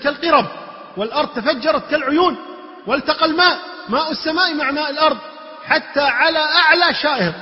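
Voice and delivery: high, loud, mounting flood account; impassioned preaching. Acted. Preacher describing waters meeting over the peaks, impassioned. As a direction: preach the disaster at full force.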